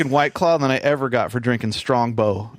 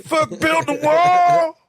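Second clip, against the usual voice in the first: exaggerated political heckler, pitched way up; Rem impression. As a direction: stretch it into a mock rallying yell. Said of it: "it's just Rem like".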